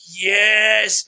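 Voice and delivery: long, loud drawn-out cheer; exultant cry. Retold or imitated. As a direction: stretch it out in triumph. Retells his delighted reaction.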